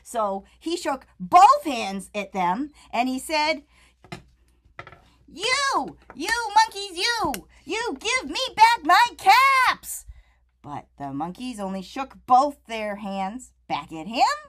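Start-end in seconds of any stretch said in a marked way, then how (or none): 5.3-10.0 higher and louder, furious peddler; angry. Acted. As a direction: shake both hands and demand. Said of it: "very angry".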